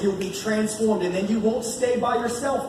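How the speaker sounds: usual speaking voice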